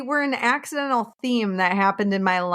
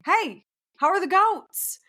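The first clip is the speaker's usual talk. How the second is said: mock yell; 10 semitones higher than usual. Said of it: "without somebody yelling".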